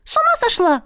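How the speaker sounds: high, squeaky little spirit, businesslike. Acted. Spirit talking business with the heroine, high squeaky voice. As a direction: pipe it up like a small sprite.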